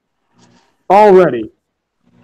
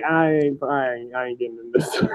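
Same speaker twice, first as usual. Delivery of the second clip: much softer, lower, trailing away; mock backing out; 6 semitones lower, 12 dB quieter than usual. Brian leaving the ring, softly muttering his refusal. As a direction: mutter it as you back off.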